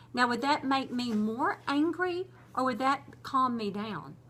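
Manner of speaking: ordinary delivery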